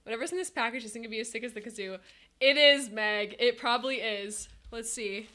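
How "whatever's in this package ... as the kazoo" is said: usual speaking voice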